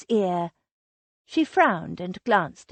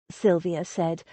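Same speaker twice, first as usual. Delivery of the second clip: usual speaking voice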